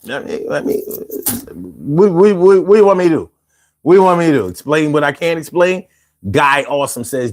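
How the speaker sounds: ordinary talk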